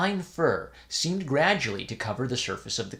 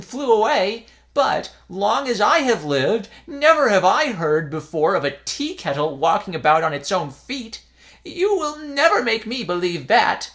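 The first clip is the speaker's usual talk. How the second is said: put-on high, louder scolding old man; scolding old priest; 8 semitones higher, 6 dB louder than usual. A narrator's reading. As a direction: chide the foolish boys. Priest lectures, disbelieving.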